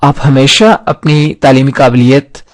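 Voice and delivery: ordinary delivery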